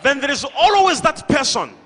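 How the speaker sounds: loud, high-pitched pulpit challenge; full-force preaching. Real, not acted. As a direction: call out to the whole packed hall.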